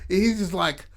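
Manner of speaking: usual speaking voice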